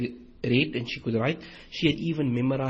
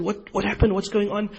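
raised, urgent alarmed asking; alarmed questions; 7 semitones higher than usual. Retold or imitated. Umar startled by his panicking neighbour, urgent alarm. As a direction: demand to know what's wrong, startled.

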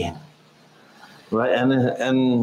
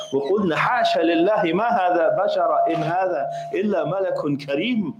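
quick Quran recitation; 4 semitones higher, much faster than usual. Acted. Quoting Quran verse.

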